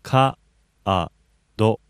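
Ka-a-do stretched syllable by syllable, long ka; over enunciating. Shown as a demonstration. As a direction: say Ka-a-do slowly with each syllable apart. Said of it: "Break it down".

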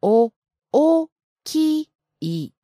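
大きい very slowly, sound by sound; broken down slowly. Shown as a demonstration. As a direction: split 大きい into drawn-out beats, お, お, き, い. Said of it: "Can you break it down even slower?".

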